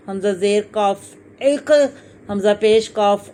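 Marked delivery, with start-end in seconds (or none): 1.4-1.7 "एक" with a back q that bounces, "iq-uh"; "एक" ending in a short rebound; qalqalah on qaf. Shown as a demonstration. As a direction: release the throaty q of "एक" with an echo. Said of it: "इसको हिलाया जाएगा".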